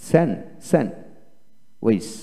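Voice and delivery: স্যান্ডউইচ slowly as "san... wis", no d; silent d. Shown as a demonstration. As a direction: break স্যান্ডউইচ after "san", with a long pause. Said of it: "ড বলি না". (ড ছাড়া ভেঙে স্যান্ডউইচ)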